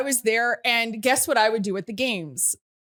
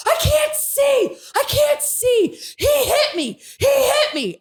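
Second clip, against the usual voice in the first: much higher, louder, slow panicked scream; 5 dB louder, slower than usual. Retold or imitated. Retelling being hit at the game, panicked slow scream. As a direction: shriek it like you've been blinded. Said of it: "I'm just screaming".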